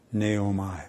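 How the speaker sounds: usual speaking voice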